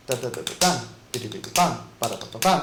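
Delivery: quiet scat syllables ending on a "bam"; vocalized drum fill. Shown as a demonstration. Drum teacher voicing a roll to students, soft scat syllables. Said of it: "a double stroke".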